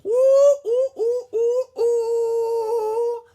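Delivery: very high, loud squealing hoots; monkey squeals. A narrator's reading. Noisy monkeys squealing near the sleepy giraffe. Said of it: "The monkeys squealed".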